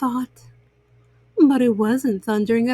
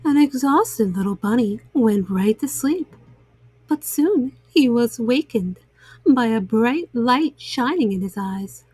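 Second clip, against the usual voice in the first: plain reading voice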